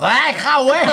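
laughing mock yell; half-laughed angry yell; mock angry shout. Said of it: "มันตะโกนว่าอะไรนะ".

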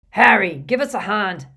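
loud, hoarse giant's call; hoarse Hagrid call. Acted. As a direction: boom it out hoarsely. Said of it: "called Hagrid hoarsely".

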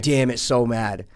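ordinary delivery

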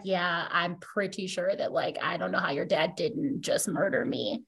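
low, soft, musing dad voice; new father. Acted. New father admitting he understands her dad, low and soft. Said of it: "they have a little girl and he's like".

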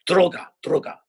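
a high, loud mock bark of "Droga, droga"; imitating police. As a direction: call out "Droga, droga" like an armed cop. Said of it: "And they're like".

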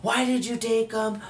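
high, loud furious yelling from a dad; angry yelling. Retold or imitated. Dad yelling at his son over the missing pills, high and loud furious shouting. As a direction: shout it like an enraged father catching his son. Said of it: "He was just yelling".

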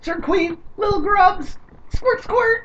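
very high, squeaky alien critter voice; squeaky creature voice. Acted. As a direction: squeal it like a tiny alien grub. Mocking Zerg creatures, laughing.

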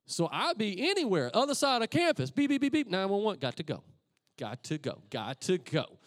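normal talking voice

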